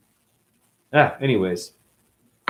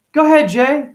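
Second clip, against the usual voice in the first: mom voice; 14 semitones higher, 9 dB louder than usual. Acted. Mom answers in imagined skit.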